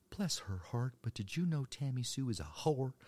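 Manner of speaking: high, loud gossiping church lady; Southern church lady. Retold or imitated. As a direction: pass on scandal as a pious Southern woman. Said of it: "forty-five-year-old women, Arkansas church".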